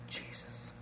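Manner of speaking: very soft, voiceless whisper; hushed re-enacted whisper, mic off. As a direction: whisper it secretly into his ear. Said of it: "I whispered with the mic off".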